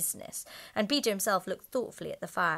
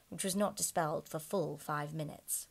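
plain reading voice

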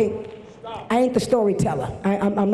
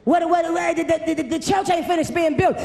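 preaching at full force; 6 semitones higher than usual. Acted. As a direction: hammer it home to the congregation, shouting. Preaching climax again.

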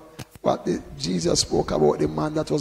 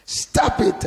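sudden louder, higher command; cross rebuke; 6 semitones higher, 5 dB louder than usual. Retold or imitated. Preacher voicing the Spirit to his congregation, cross. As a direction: cut in sharply, cross. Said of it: "the Spirit will get cross at certain things".

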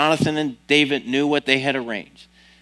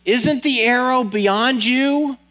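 calling out; 9 semitones higher, slower than usual. A narrator's reading. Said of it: "Jonathan called out after him".

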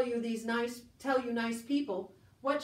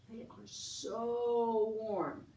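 drawn-out cozy; 3 dB quieter, much slower than usual. Acted. Savoring the soft down shirt.

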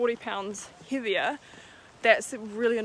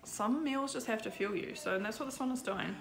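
ordinary talk, nothing marked

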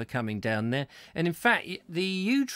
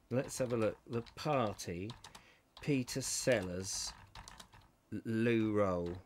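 muttering to himself; 6 semitones lower, 10 dB quieter, slower than usual. Real, not acted. Muttering while searching.

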